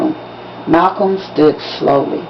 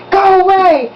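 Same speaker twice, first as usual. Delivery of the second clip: hollered; 11 semitones higher than usual. A narrator's reading. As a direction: yell it across the auditorium. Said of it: "stood in their seats and hollered".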